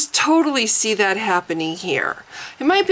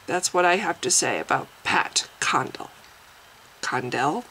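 whispered aside; 4 semitones lower, 5 dB quieter than usual. Real, not acted. Sign-off aside.

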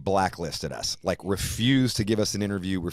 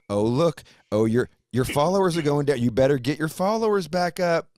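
higher, louder nagging mock voice; mock pushy app; 7 semitones higher, 4 dB louder than usual. Mocking Instagram nagging him about lost followers, higher and louder. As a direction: nag like a pushy app alert. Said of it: "this is just like".

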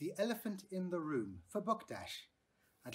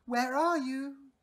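calling out; 9 semitones higher, 8 dB louder, slower than usual. A narrator's reading. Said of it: "she called".

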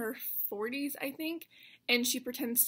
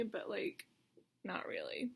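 whispered; 3 semitones lower, 7 dB quieter than usual. Real, not acted. Muttered aside after failed joke.

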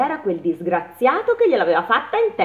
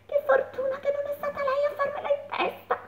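high, soft relieved mole; mole's thought; 7 dB quieter than usual. A narrator's reading. The mole relieved after the cow's pile, high and soft. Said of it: "pensò la piccola talpa".

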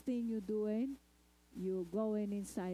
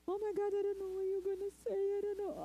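crying coworker; 10 semitones higher, faster than usual. Retold or imitated. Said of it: "and start crying".

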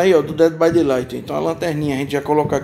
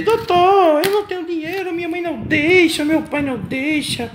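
imitating a pleading child, much higher; mock child begging, pitched up high; whiny kid; 10 semitones higher than usual. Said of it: "Muita gente pode dizer". (criança pedindo, voz bem aguda)

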